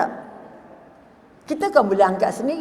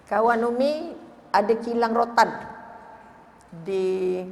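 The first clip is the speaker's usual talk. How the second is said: usual speaking voice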